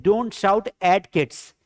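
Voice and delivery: very slow, word by word, for the class; slow model sentence. Shown as a demonstration. Teacher dictating an English sentence to students, very slowly. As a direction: spell each English word out slowly for learners. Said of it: "ऐसा भी बोल सकते हैं".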